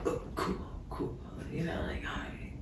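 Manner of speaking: whispering his casual cool, cool; whispered mimicry. Mimicking the guy's murmured reply while cuddling, whispering. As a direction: breathe it out barely voiced. Imitating his quiet response.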